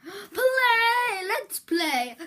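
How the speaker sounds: bouncy gamer opener; mock excitement. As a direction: burst in eager.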